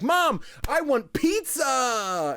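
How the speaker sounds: put-on high, slow whiny kid begging. Son begging mom for pizza, whiny kid voice. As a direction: drag it out like a kid wanting pizza. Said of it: "He's like".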